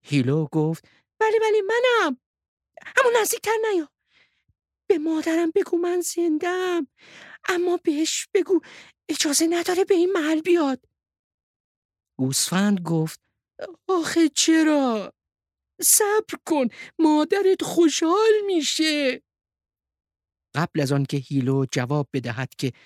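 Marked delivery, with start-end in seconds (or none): 1.2-10.8 high-pitched little character voice; Hilo the hidden sheep. Acted. Hilo warns a fellow sheep to stay away, high character voice. 13.9-19.2 high-pitched storybook sheep voice; puzzled, eager sheep. Acted. Sheep tells Hilo that mother will be happy, eager high voice.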